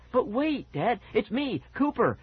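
high, desperate plea; urgent high-pitched calling; trying to yell. A narrator's reading. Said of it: "I tried to yell".

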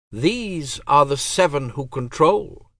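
high, cold divine voice; goddess voice. Acted. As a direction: speak as an aloof goddess. Goddess explains.

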